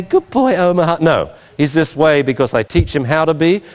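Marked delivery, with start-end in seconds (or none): 0.0-1.0 high-pitched sugary dog praise; mock sweet. Owner praising the dog Omaha, mock sweet. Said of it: "because I'm sweet and say".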